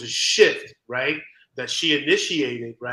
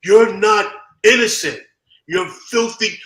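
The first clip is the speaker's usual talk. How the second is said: louder and higher, cutting it short; blunt call-out; 7 semitones higher, 9 dB louder than usual. Retold or imitated. Best friend breaking a silent ride to call him out, loud. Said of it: "he turns to me and goes".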